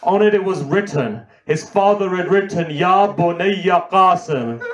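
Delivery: mournful climactic recitation. Acted. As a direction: push the grief to its peak for the mourners.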